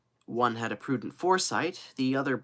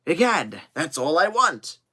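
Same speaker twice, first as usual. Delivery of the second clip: loud, raised, hearty outburst; emphatic exclamation; 5 semitones higher, 7 dB louder than usual. Acted. Kennedy hoping the machine cannot go up, loud exclamation. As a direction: burst out emphatically.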